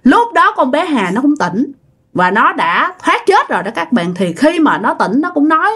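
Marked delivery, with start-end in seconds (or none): none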